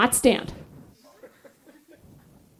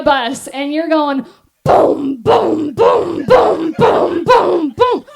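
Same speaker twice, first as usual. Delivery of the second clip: mock tumbling; 3 semitones higher, much slower than usual. Falling down the bus steps.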